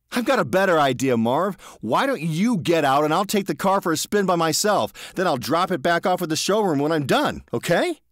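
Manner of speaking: high, loud breezy proposal; smug offer to take the car; cocky George. A narrator's reading.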